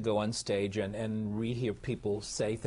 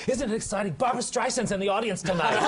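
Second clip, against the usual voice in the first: imitating an excited kid, higher pitched; 10 semitones higher, 4 dB louder than usual. A kid backstage telling the nervous actor who is out front, excited. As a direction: gush it as a thrilled backstage kid.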